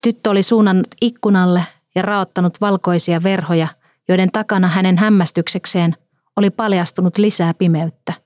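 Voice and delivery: usual speaking voice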